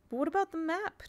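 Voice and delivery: wail. Acted. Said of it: "Jane wailed".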